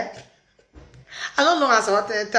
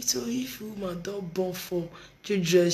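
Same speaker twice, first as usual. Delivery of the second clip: usual speaking voice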